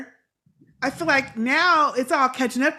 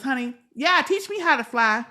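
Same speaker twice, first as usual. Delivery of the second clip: pitched-up, playing along eagerly; mock eager agreement; 4 semitones higher than usual. Podcaster pretending to go along with the plan, mock eager. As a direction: act out an over-willing yes. Acting out what she'd have said.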